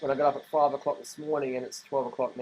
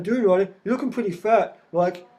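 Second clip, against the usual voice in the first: higher, louder taunting voice; mocking commenters telling Ryder to lean up; mock critic; 5 semitones higher, 6 dB louder than usual. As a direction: jeer at him through a laugh. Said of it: "I've got anorexics out there going".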